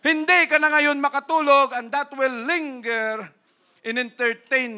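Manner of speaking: loud, high, driving sermon voice; fiery preaching. Acted. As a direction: preach it hard at the congregation. Preaching at full force.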